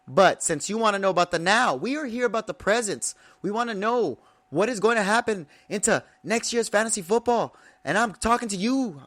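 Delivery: high, pumped-up wrestling promo voice. Pretend. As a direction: hype it like a WWE promo. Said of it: "I feel like I'm just doing a wrestling promo right now".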